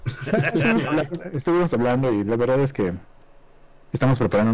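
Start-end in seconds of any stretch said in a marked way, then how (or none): 0.0-1.2 giggling through the words; words breaking into laughter; laughing. Real, not acted. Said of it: "¿Por qué te ríes".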